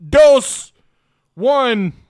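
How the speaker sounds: shouted countdown, rising excitement; hyped countdown. Acted. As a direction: call the countdown loud and high. Countdown to show start.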